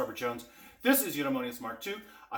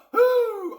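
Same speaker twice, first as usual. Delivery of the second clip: loud high cheer; excited whoop; 12 dB louder than usual. Real, not acted. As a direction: whoop with joy. Cheering at the reveal.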